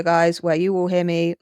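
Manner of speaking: ordinary talk, nothing marked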